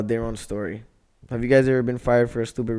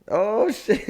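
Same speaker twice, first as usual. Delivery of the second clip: laughing; 9 semitones higher, 5 dB quieter than usual. Real, not acted. Laughing at radio bit.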